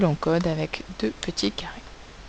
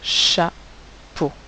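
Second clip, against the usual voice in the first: "chapeau" slow, broken into cha and po; syllable by syllable. Shown as a demonstration. Teacher giving the class a word to write, "chapeau" slowly split. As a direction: dictate "chapeau" with a pause between syllables.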